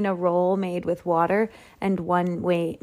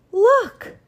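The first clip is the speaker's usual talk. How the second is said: high, loud, excited call; urgent shout; 7 dB louder than usual. A narrator's reading. Son pointing his father to the dough, urgent shout. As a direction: call out sharply for attention. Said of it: "shouted Walter Junior".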